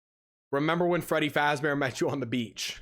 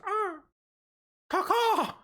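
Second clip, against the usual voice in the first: high squawked "caw-caw"; seagull caw; slower than usual. Shown as a demonstration. Mimicking beach gulls for chat, high squawk. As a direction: caw like a gull eyeing your sandwich. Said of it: "Like when you're at the beach".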